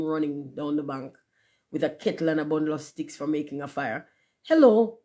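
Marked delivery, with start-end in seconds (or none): 4.4-5.0 loud, high hello; cried out. A narrator's reading. Boy finding the rocket in the ditch, calling out. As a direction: call out on spotting something. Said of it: "cried one of the boys".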